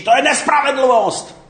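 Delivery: indignant, high-pitched outcry; crying out inside. Acted. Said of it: "Okamžitě to ve vás křičí".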